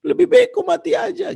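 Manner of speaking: hammed-up teen crying at papa; crying child. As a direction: whine it like a sobbing teen. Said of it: "si anak langsung nangis".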